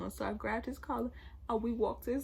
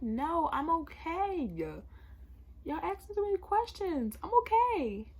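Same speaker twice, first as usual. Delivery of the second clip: exasperated insistence; 6 semitones higher than usual. Retold or imitated. Upset girl to best friend asking questions, exasperated. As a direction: brush off questions, pitch climbing as you insist.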